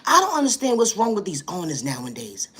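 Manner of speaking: usual speaking voice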